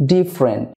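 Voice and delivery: "ডিফারেন্ট" as dif-ren, no vowel before r; native-style different. Shown as a demonstration. Teacher shows how natives say it, "ডিফারেন্ট" as dif-ren. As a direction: say "ডিফারেন্ট" in two falling syllables, DIF-ren. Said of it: "নেটিভরা বলবে".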